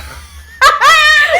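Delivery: loud high shrieking laugh; loud, high burst of laughter. Real, not acted. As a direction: explode into laughter. Suddenly remembering something funny.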